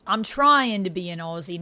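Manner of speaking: ordinary talk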